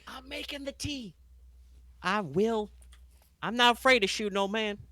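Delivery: high, sharp and sassy; sassy woman voice. Acted. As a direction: snap back with high sass. Harley snaps back at old man.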